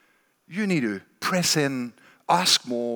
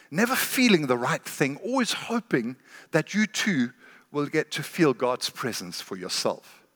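normal talking voice

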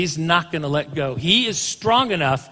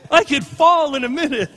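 high, loud startled cry at a cliff edge; a hammed-up gasp of fright; mock fearful cry; 8 semitones higher, 6 dB louder, slower than usual. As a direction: yelp like you're about to fall. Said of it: "No one walks up and goes".

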